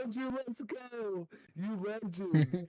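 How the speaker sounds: slow, drawn-out hype with a loud last word; big it up for your teammate; hyped cheer. Retold or imitated. Cheering a teammate who spiked a senior, loud on legend.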